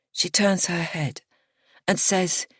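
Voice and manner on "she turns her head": plain reading voice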